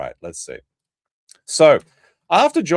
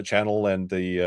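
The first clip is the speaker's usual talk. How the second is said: usual speaking voice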